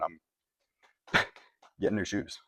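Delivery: slow words broken by a real laugh; choked-up laugh on the last words. Real, not acted. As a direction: laugh through it, drawn out. Emotional shoe story, laughs.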